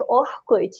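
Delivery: Orkut with R breathed like an H; Brazilian pronunciation. Shown as a demonstration. Explaining how Brazil pronounces it, "Orkut" with H-like R. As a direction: say "Orkut" with an H sound for the R. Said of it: "we pronounce it like".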